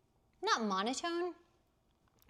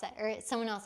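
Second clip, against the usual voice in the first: normal talking voice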